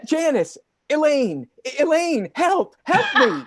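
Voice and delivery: frantic high cries for help; panicked cries. Acted.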